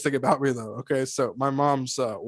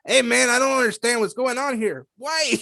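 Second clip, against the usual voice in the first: Cheech Marin; 10 semitones higher than usual. Retold or imitated. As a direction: play it like a confused Cheech Marin. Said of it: "some Cheech Marin".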